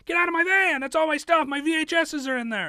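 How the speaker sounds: high, loud plea to save your stuff; angry protest. Retold or imitated. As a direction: yell them out of your van. Acted quarrel over the van.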